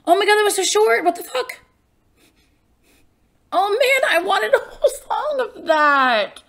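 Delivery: loud, high-pitched disbelief; high, loud protest at being short-changed; shocked disappointment. Real, not acted. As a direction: cry out, let down by the abrupt ending. Shocked the song ended so soon.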